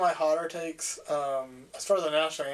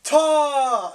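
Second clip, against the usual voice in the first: loud high shout; crowd yell; 10 semitones higher, 10 dB louder than usual. Retold or imitated. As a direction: bark it like a hockey crowd. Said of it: "they all yell".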